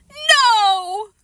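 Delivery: high, loud crying shout. A narrator's reading. Boy wailing no to Mom, crying. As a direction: wail it. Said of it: "Fudge started crying again".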